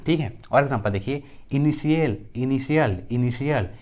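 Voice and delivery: normal talking voice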